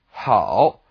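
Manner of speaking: "hao" low dip, then up; third tone. Shown as a demonstration. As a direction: say "hao" with a full falling-rising tone. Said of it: "are both third tone".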